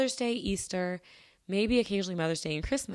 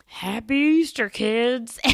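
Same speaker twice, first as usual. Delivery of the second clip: louder, higher gruff holiday holler; gruff old man; 4 semitones higher, 9 dB louder, much slower than usual. Retold or imitated. Grungy old guy greeting kids at the mall, gruff holler. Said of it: "with his little gruff".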